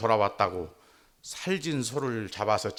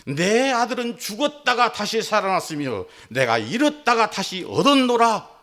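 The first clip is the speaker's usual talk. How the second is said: high, loud, ringing cry of joy; joyful father proclaiming; 10 semitones higher, 5 dB louder than usual. Retold or imitated. Father announcing his lost son's return, jubilant and loud. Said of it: "아버지는 말합니다".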